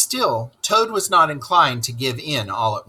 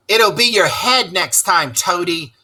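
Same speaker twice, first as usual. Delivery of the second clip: called out taunt; 6 semitones higher, 3 dB louder than usual. Acted. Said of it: "the toads called out to him".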